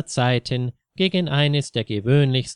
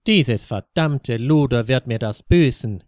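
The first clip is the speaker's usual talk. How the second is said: plain reading voice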